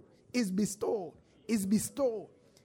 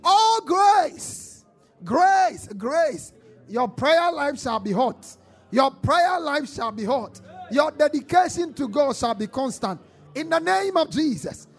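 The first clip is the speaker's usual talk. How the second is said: loud, high prayer declarations; fiery preaching; 6 semitones higher, 10 dB louder, slower than usual. Acted. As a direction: declare it with full force.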